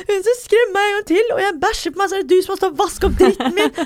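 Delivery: high, loud furious shouting at the staff; retold furious yell. Scared girl yells angrily at the escape room staff. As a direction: yell it up high, angry enough to threaten them. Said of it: "står og skriker til høyttalerne".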